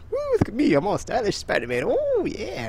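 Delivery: high, cocky show-off voice. Retold or imitated. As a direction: crow like a showing-off hero. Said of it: "it's like".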